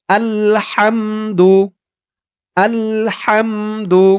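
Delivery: slow, stretched আলহামদু for students to repeat; model recitation. Shown as a demonstration. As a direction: draw out আলহামদু so the class can copy. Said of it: "যেমন উচ্চারণ করব, যেমন টান দিব". (ধীরে টেনে আলহামদু)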